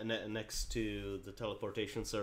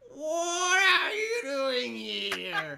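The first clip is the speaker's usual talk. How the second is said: put-on very old woman; 10 dB louder, much slower than usual. GM voices the very old lady.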